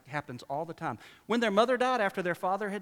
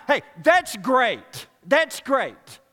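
sarcastic; 6 semitones higher, 12 dB louder, much slower than usual. Real, not acted. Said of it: "sarcastic reply".

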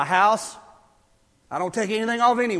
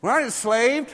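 loud chest-thumping boast; mock patriotic bravado; 4 semitones higher, 11 dB louder than usual. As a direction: shout it like a proud patriot, tongue in cheek. Mocking proud patriotic bravado.

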